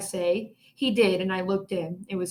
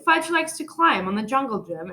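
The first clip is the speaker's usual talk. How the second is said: usual speaking voice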